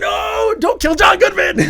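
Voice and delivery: very high, loud desperate cry; pleading shout. Retold or imitated. Fan retelling his plea to the screen, high pleading shout. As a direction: beg the screen to spare him. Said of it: "And I'm like".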